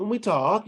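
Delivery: "when we talk" with the voice climbing on "talk"; rising intonation. Shown as a demonstration. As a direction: lift "talk" at the end as if asking. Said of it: "you get this constant rising intonation".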